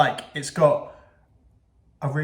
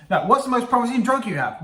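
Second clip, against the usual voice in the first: higher-pitched, quicker acted question; lively retake in a raised voice; eager mock questioning; 8 semitones higher, 4 dB louder than usual. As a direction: ask it high, without dragging it out. Restarts the acted question to scientists.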